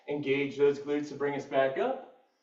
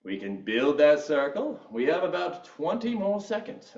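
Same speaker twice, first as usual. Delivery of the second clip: swooping British fitness instructor; lively mock-British workout cues; British accent. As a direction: coach the class in a put-on British accent. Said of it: "I'm gonna stop with my British accent".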